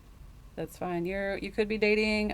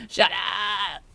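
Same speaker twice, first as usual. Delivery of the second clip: mock shout. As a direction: bark Shut up. at them, half joking. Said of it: "and you're like".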